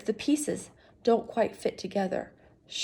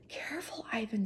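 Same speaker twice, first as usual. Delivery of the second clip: unvoiced hushed whisper; 10 dB quieter than usual. Reader whispering a careful warning, hushed. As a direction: breathe it out as a whisper.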